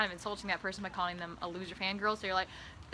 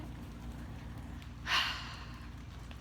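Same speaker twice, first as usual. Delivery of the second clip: soft long sigh; dreamy sigh; 10 dB quieter than usual. Acted. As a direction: sigh blissfully.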